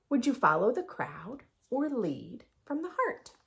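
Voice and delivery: lively sing-song picture book read; reading to children. A narrator's reading. Teacher reads the rhyming book to her class. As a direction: read the rhymes aloud with bounce.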